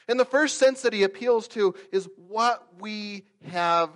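high-pitched, emphatic sermon delivery; forceful preaching. Acted. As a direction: drive the point home to the congregation.

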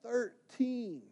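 high, soft reply from far away; small, high, far-off answer; distant voice. Retold or imitated. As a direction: imitate a boy answering faintly from the distance. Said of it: "you could hear in the distance".

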